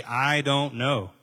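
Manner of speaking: slow calm "I don't know." with a long hold on don't; drawn-out "I don't know." to a friend; slow, honest admission. Shown as a demonstration. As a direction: model saying "I don't know." slowly, without shame. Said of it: "It's okay to ... and say".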